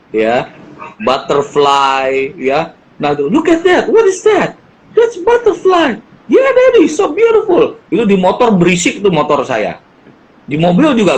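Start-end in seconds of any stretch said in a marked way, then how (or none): 3.3-4.6 put-on higher, bright kid pointing something out; lightly voiced curious child; excited child. Child on motorbike retold. 4.9-6.0 teaching voice. Retold or imitated. Father teaching child retold. 6.2-7.8 put-on much higher, louder gushing kid voice; hammed-up child's delight.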